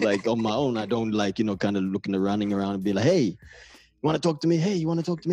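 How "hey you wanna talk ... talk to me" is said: high-pitched mock pleading for attention; needy hanger-on, hammed up; eager pestering. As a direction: pester strangers, over-eager and higher. Mimics someone eagerly seeking company.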